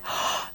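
a sharp, surprised intake of breath; gasp. Retold or imitated. Grandmother gasps in surprise, a quick sharp breath. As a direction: gasp as grandmother Maudie did. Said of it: "she gasped".

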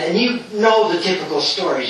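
usual speaking voice

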